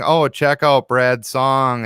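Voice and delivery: usual speaking voice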